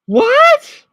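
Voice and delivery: high, loud shocked what. Real, not acted. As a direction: blurt it out in disbelief. Shocked reading word list.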